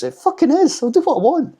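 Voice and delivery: high, loud mocking imitation; rude mock retort. Vlogger imitating a creator's rude reply to comments, rude mock retort. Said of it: "he responds so rudely".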